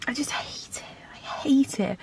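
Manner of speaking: whispered through tears; tearful whisper. Real, not acted. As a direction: whisper it, close to crying. Tearful about the vet trip.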